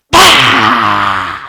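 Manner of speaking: loud, long open-throated roar; full-volume pretend lion roar; big lion roar. Shown as a demonstration. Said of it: "let's do a big lion roar".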